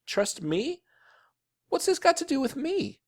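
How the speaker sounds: very high, incredulous protest; indignant boy. A narrator's reading. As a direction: squeal it in disbelief at being blamed. Boy protests being blamed.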